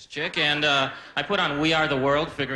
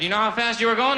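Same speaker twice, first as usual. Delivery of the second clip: raised, pushy cop; stern cop; 6 semitones higher, 5 dB louder than usual. Retold or imitated. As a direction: bark it like a traffic cop. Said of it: "He said".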